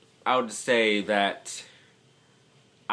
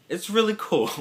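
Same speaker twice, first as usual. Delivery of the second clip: excited; 12 semitones higher, 4 dB louder than usual. Real, not acted. Excited about Vietnamese language.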